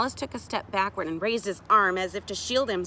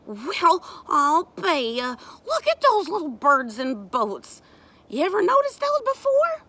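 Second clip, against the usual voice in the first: high, loud mock amazement; child feigning surprise; 9 semitones higher, 7 dB louder than usual. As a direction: act as if just noticing.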